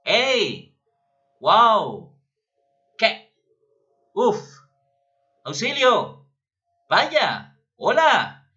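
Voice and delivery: each word shouted high and loud; exclaimed interjections. Shown as a demonstration. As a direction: belt out every exclamation with feeling. (interjecciones exclamadas, agudas y fuertes)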